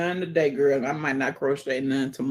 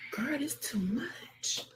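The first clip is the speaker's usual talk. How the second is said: slow, overwhelmed whisper; 8 semitones higher, 10 dB quieter, slower than usual. Real, not acted. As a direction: breathe it out, overwhelmed.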